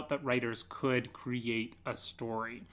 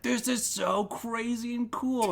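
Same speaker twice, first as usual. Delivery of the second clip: high, slow, awed; excited wonder; 10 semitones higher than usual. Retold or imitated. Guest recalls finding the ruin, high excited wonder. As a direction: relive the thrill of the find. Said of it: "I was like".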